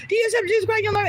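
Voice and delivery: high-pitched mock yell of a zealot; imitated yelling. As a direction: yell it like someone accosting a stranger. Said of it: "when he was just like".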